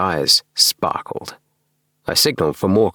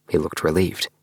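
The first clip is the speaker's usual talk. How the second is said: ordinary delivery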